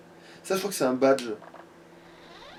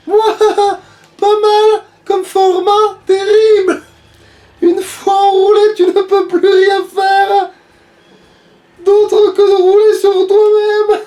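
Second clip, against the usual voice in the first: witch voice; 9 semitones higher, 3 dB louder, slower than usual. Acted. Witch voice kept in play.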